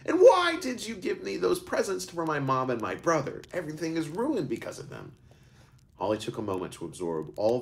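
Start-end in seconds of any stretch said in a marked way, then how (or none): 0.1-5.1 put-on much higher, distressed boy; a boy blaming the gifts, close to tears; upset questioning. Boy continues frantic questioning.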